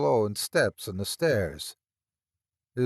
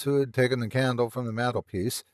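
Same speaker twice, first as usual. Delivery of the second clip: ordinary delivery, nothing marked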